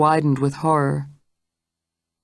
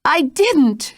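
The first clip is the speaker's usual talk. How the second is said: much higher, breathless denial; gasped; 12 semitones higher, 4 dB louder than usual. Acted. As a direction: gasp out the denial in terror. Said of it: "she gasped".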